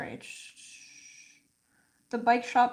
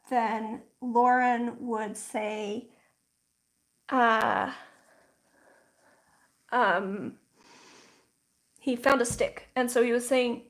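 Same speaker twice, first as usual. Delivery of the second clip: ordinary talk, nothing marked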